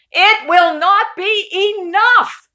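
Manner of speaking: loud, high group shout, a little slow; shouting together. Acted. The whole family shouting at Uncle Murray. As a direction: everyone calls it out at once. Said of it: "everyone shouted".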